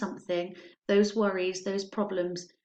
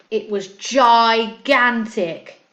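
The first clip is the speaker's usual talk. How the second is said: gigantic stretched slow and much louder; drawn-out emphasis; 12 dB louder, much slower than usual. A narrator's reading. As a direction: make gigantic sound enormous. Monster grows huge in story.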